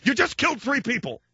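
high, pointed reproach; mock scolding. Father scolding son after crosswalk, high voice. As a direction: tell him off in a high voice.

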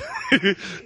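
short, high burst of laughter. Real, not acted. Host amused by his own accent demo, laughing. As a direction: crack up briefly.

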